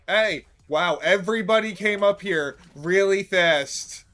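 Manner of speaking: loud startled hey; surprised shout. Real, not acted. Player shocked everyone rushed up, loud surprise. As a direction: burst out at the crowd rushing in.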